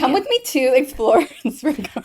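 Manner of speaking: playful adventure voice. Pretend. Mock adventure invitation.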